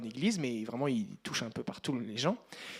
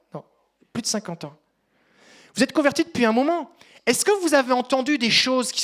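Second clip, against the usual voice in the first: louder, higher preacher voice; preaching; 7 semitones higher, 9 dB louder than usual. Acted. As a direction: call out to the congregation. Preaching to the congregation.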